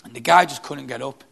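ordinary delivery

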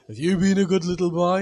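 very loud, booming Santa voice. Retold or imitated. Dad as Santa questioning his son, booming. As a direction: bellow it like a pantomime Santa. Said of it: "and I said to Joe".

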